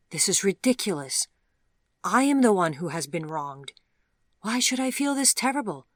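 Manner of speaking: upset thought. Acted. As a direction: let her frustration spill out, loud and high. Vivian's upset inner thought.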